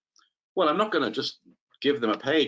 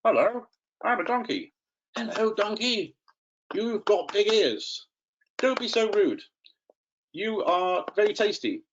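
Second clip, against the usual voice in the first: higher, sing-song toy animal chat; playful puppet voices; 7 semitones higher than usual. Shown as a demonstration. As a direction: voice two toy animals for children. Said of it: "they could have conversations".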